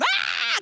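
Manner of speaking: re-enacted child's high shriek; high scream. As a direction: act out a short terrified scream. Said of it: "I'm screaming".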